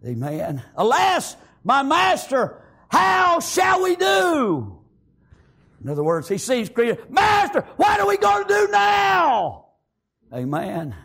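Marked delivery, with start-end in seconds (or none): 0.8-4.8 high, very slow shouted plea; desperate cry. Acted. Servant begs his master what to do, shouting. 7.1-9.7 high, shouted plea; panicked shout. Acted. Servant shouts to his master in panic.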